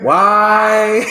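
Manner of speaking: WHY sung out high and loud; high-note question. Shown as a demonstration. Teacher showing the reader voice inflection, high-pitched WHY. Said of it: "You gotta go to the high note".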